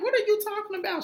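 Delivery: hammed-up disbelief, fast; mock baffled. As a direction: squeal it in disbelief. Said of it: "And they're like".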